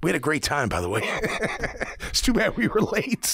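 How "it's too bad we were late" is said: laughing through the words, voice lifted high. Real, not acted. Player joking about being late to the meeting, laughing. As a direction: crack up while saying it. Laughing at his own joke.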